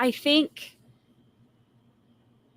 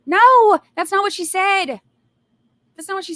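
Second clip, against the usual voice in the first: much higher and louder, indignant; outraged shout; 10 semitones higher, 9 dB louder than usual. Real, not acted. Venting to viewers at the defense lawyer, an outraged shout. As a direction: fire it back at the lawyer, furious.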